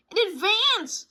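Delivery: high, loud sputtering blurt; sputtered. Acted. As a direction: blurt it out breathlessly. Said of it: "sputtered brother".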